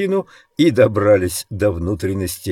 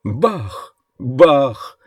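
higher-pitched gleeful gunshot words; exultant; 6 semitones higher than usual. A narrator's reading. Roland rejoices after shooting the colonel, exultant. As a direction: crow each bang with glee. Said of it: "ликовал Роланд".

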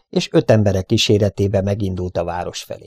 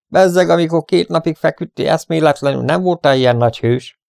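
higher-pitched, scornful jibe; taunting; 8 semitones higher, 4 dB louder than usual. A narrator's reading. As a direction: needle the captive hero. Bandit taunting the captive hero.

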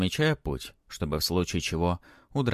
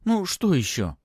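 put-on impatient girl, higher and louder; impatient hurrying; 11 semitones higher, 5 dB louder than usual. A narrator's reading. Girl hurrying Matvey to go on, impatient. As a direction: hurry him along, pitched up. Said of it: "поторопила его девочка".

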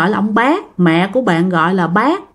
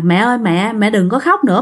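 usual speaking voice

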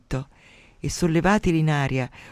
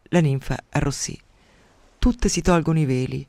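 ordinary delivery

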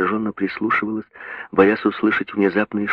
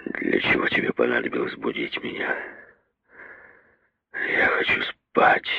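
put-on low, slurred, softer drunken mumble; blackout drunk; 9 semitones lower, 5 dB quieter than usual. A narrator's reading. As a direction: mumble like too drunk to wake. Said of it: "еле ворочая языком".

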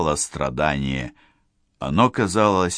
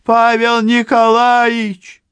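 high, loud, drawn-out yell; frantic scream; 8 dB louder, slower than usual. Acted. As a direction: scream down the corridor. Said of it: "истошно крича".

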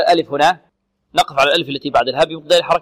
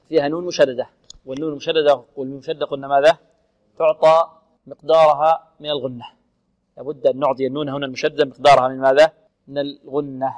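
ordinary talk

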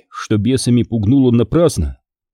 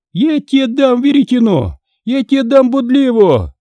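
much higher, louder shrewish tirade; shrill scolding wife; 13 semitones higher, 3 dB louder than usual. Acted. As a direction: rail at the monk like a furious peasant wife. Wife threatens with shovel.

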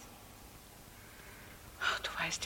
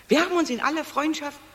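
higher, louder, fast defensive retort; defensive outburst; 6 semitones higher, 6 dB louder, faster than usual. Acted. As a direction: snap back at the mother. Streit mit Mutter. (heftige Verteidigung gegenüber der Mutter)

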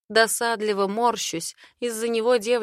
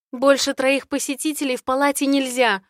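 stubborn insistence; 6 semitones higher, 5 dB louder than usual. Acted. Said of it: "упрямствую я".

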